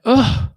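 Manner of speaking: high, loud "ugh" of frustration; exasperated groan. Retold or imitated. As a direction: let out a sudden frustrated groan. Frustrated reaction in retold quarrel.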